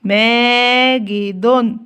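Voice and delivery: ماجد stretched out, each syllable drawn long. Shown as a demonstration. Teacher sounding a word out for young pupils, very slow and drawn out. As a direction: sound out ماجد slowly for the class, ending in مَاجِدٌ. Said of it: "زي كلمة مثلًا ماجد".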